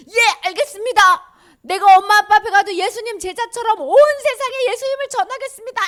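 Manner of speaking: loud, high, gung-ho acted shout; eager vow. Healed man promising Jesus to tell the world, eager shout. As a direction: belt it out like a keen new disciple.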